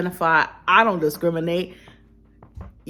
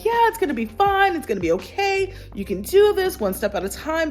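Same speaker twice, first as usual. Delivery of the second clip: cheery advice; 10 semitones higher than usual. Retold or imitated. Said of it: "I can tell people, like".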